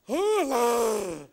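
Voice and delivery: put-on slow, drawn-out children's show bear; big swooping puppet-bear voice; kindly bear. Bear introducing himself to children, kindly bear voice. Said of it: "Me llamo el oso bondadoso".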